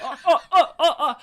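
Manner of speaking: high delighted squealing; excited squeals. Real, not acted.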